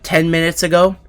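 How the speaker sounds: normal talking voice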